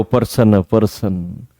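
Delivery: पर्सन, imitating students who say प्रश्न as English person; mocking wrong pronunciation. Teacher mocks students who turn प्रश्न into person. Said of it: "पर्सन तो अंग्रेजी का word है".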